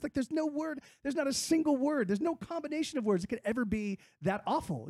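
high, disbelieving protest; incredulous outburst. Retold or imitated. Woodcutter to the boy, high and disbelieving. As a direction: pitch way up, as if it couldn't be true. Lumberjack outraged on kid's behalf.

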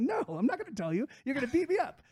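high, panicky no; scared refusal. Retold or imitated. Little Johnny refusing the lumberjack, high and scared. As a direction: rise high like a kid dreading a beating. Kid refusing, fearing a beating.

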